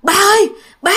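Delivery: high urgent cry for dad; frightened child calling. Retold or imitated. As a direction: call out to dad in alarm. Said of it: "nó kêu".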